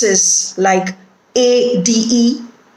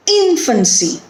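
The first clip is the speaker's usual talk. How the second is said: "Infancy" with a higher IN, then falling; first-syllable stress; 4 semitones higher than usual. Shown as a demonstration. Teacher giving an example of third-from-last stress, first-syllable stress on "Infancy". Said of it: "the first syllable is stressed".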